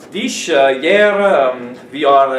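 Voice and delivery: halting, laboured words with fillers; struggling language learner. Acted. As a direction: grope for each word like a beginner. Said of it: "the way that you're struggling with language".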